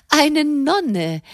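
high-pitched mock astonishment; astonished. A narrator's reading. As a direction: she can hardly believe it. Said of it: "rief Madame erstaunt".

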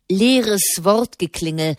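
ordinary delivery

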